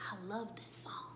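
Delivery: menacing retold whisper. Goon plays racist song, taunting.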